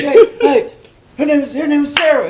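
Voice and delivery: high, loud, triumphant announcement; excited report. Retold or imitated. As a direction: call out the good news to your boss. Excitedly reports first success to boss.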